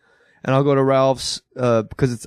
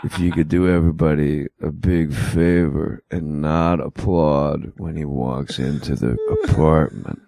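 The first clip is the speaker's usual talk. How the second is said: low, slow deadpan monotone warm-up; Stephen Wright; 9 semitones lower than usual. Retold or imitated. Comic warming up a sitcom audience, as a slow low deadpan. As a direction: drone the warm-up slowly and flatly, way down low. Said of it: "Stephen Wright doing audience warm up".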